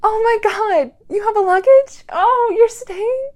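loud, high, thrilled questions; delighted surprise. Acted. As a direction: squeal as the good news sinks in. Delighted they brought luggage.

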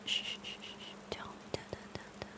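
breathy shushing, barely audible; hushing whisper. Acted. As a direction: hush him down to silence. Hushing the frightened captive.

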